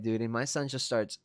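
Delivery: usual speaking voice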